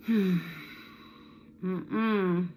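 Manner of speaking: loud, drawn-out skeptical hum then refusal; disapproving hum. Pretend. Mother refusing to pay her daughter's medical bills. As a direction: hum a firm no-way.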